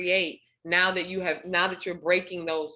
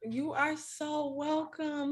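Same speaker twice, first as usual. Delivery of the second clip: slow, softer, tender welcome; warm; 8 semitones higher, 6 dB quieter, much slower than usual. Real, not acted. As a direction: draw each word out gently, softly. Affirming a grateful sharer.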